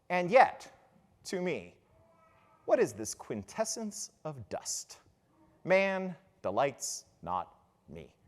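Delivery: low, soft, slow soliloquy; hushed, disillusioned recitation, falling away; Hamlet. Acted. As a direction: let it sink quietly with long pauses. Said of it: "he says".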